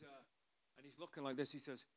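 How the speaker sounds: ordinary talk, nothing marked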